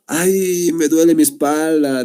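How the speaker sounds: mock groan of a sore back; back pain. Shown as a demonstration. Teacher imitates someone complaining of back pain, mock pained. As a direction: complain as if your back aches.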